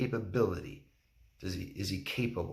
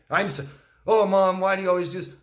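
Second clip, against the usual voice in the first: much higher and louder mock wailing; hammed-up self-pity, rising to a shriek; 10 dB louder than usual. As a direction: wail it like a martyr fishing for pity. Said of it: "they're saying that".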